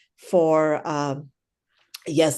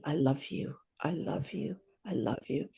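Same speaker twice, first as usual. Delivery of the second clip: soft, hushed loving murmur, much softer; tender inner words; 10 dB quieter than usual. Retold or imitated. Gazing at a workshop partner, a soft tender murmur. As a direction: quietly repeat love to the person you face. Silent loving thought in mirror work.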